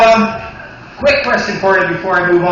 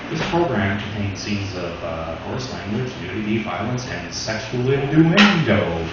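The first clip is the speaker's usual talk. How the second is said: TV warning voice; 8 semitones lower, 4 dB quieter than usual. Retold or imitated. Comic quoting the new TV warning, low announcer voice. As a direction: read it like a broadcast advisory. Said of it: "It says, uh".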